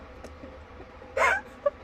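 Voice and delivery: long, very high wheezing laugh; helpless laughing. Real, not acted. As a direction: crack up completely. Cracks up at the fall.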